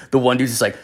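ordinary delivery

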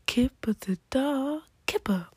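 mock British cartoon announcer; British accent impression. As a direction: say it like a British children's show title. Imitates Kipper show title.

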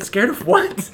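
lightly voiced nurse. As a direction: ask it like a baffled nurse. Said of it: "She said".

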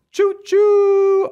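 high, loud "choo-choo"; train whistle imitation. Host announcing the midfield DRS train, loud high train whistle. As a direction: hoot like a train, last note held long.

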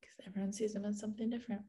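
quick hushed whisper. Real, not acted. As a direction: whisper it fast, keeping the secret. Hushed spoiler-avoiding answer.

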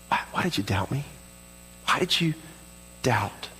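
soft, low, broken by pauses; gentle Jesus. Acted. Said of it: "Jesus says".

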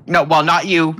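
ordinary talk

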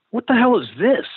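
high, loud baffled cry of disbelief; baffled outburst. Retold or imitated. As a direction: blurt it out as the confused singer hearing it. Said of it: "he's going".

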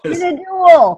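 high, loud burst of an answer; excited blurt. Real, not acted. Player interrupts the quizmaster's options, excited blurt. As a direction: call it out over the host.

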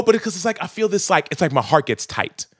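normal talking voice

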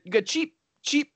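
high-pitched mock Indian accent on "cheap"; imitated Indian accent. Retelling the customer who kept on at Steve, mock Indian accent. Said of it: "trying to imitate the accent that he was saying".

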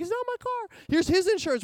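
high, strained plea to the officers; panicky. Retold or imitated. Telling the cops it's not his car, panicky high voice. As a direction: squeak it out, pleading. Pleading with police.

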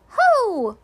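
high, loud, gleeful little HO; small creature's delighted call; happy cry. A narrator's reading. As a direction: pipe it out happily, way up high. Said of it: "cried Piglet, and smiled happily".

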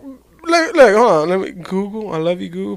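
ordinary delivery

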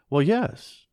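put-on a delighted boy answering his mother; brightly. A narrator's reading. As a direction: chirp it out, pleased to share. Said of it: "he said brightly".

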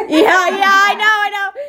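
high, loud yelping laugh; laughing yelps. Real, not acted. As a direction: laugh through it like barking. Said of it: "You sound like a dog".

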